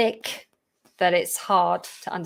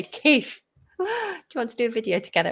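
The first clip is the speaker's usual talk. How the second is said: higher-pitched mock call to a colleague, then a quick request; pretend eager greeting; 3 semitones higher than usual. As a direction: call out the name high, gasp, then ask quickly. Imagined request to Keith.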